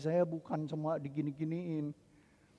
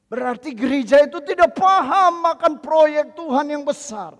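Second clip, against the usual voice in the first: loud, high preaching to the congregation; fiery preaching; 13 semitones higher, 19 dB louder than usual. Acted. Pastor proclaiming God's great project to congregation, fiery preaching.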